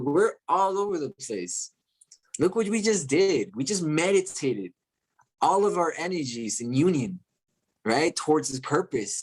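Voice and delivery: normal talking voice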